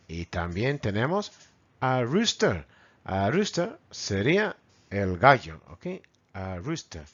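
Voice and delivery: ordinary talk, nothing marked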